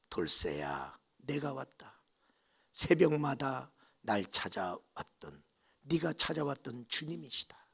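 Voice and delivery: low, hushed, unhurried; gentle voice of the Lord. Acted. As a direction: softly call to a sick old friend, low and solemn. 병든 돌쇠를 찾아온 주님. (낮고 부드러운 주님의 음성)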